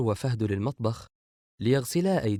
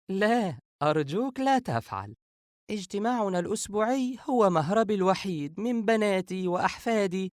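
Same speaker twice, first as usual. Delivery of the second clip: laughing; 10 semitones higher than usual. A narrator's reading. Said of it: "عائشة ضاحكة".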